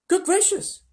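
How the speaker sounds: very high, loud astonished cry; Charlie. A narrator's reading. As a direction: exclaim like a startled boy. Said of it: "cried Charlie".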